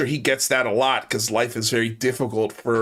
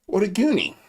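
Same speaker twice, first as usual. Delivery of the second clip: pitched-up mocking exclamation; higher mocking repeat; 7 semitones higher than usual. Shown as a demonstration. Host mocks the troll caller again, higher and scornful. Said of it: "repeats line differently".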